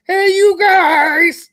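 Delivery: very slow, stretched puppet call; high frog holler, every word dragged long; drawn-out holler. Acted. Puppet host hailing friends in the chat, long drawn-out call.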